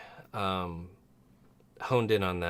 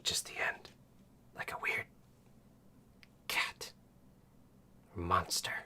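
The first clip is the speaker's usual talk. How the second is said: whispered snake lady; hushed serpent woman; snake mom voice; 7 dB quieter than usual. Acted. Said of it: "Snake Mom's voice".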